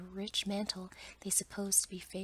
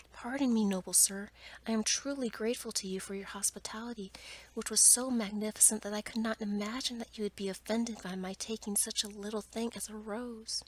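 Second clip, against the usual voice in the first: ordinary delivery, nothing marked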